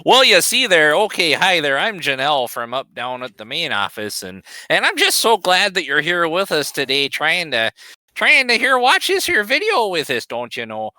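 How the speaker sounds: folksy North Dakota office lady voice; chirpy Upper Midwest accent, lightly done. Pretend. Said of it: "in your North Dakota voice".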